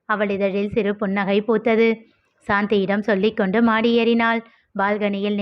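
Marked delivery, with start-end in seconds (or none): none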